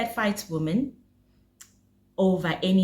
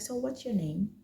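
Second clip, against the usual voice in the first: softer, gentle question; softly asking the ex her name; 10 dB quieter than usual. Retold or imitated. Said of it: "Very soft".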